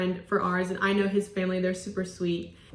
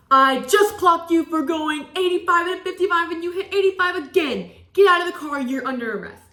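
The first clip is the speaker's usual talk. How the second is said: higher, louder stern cop voice; stern officer; 6 semitones higher, 4 dB louder than usual. Retold or imitated. State trooper arresting her, mock stern. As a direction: bark it like a strict trooper.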